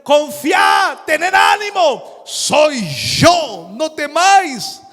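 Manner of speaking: high, loud, slow commanding shout. Acted. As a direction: shout reassurance across the storm. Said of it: "les tiene que gritar". (grito fuerte, agudo y pausado)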